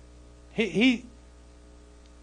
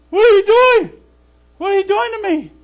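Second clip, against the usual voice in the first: much higher and louder, indignant; complaining to God; 12 semitones higher, 8 dB louder than usual. Pretend. Believer charged by a bear, yelling at Yahuwah: indignant high complaint. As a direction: plead as if the bear is on you. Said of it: "You'd be like".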